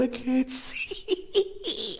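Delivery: squeezed out high and slow through laughter; words stretched by a laugh fit; laughing, squeaky. Real, not acted. Streamer blinded by tears, high laugh-squeezed whine.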